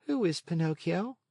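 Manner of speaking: puzzled little creature; snail. A narrator's reading. Snail asking.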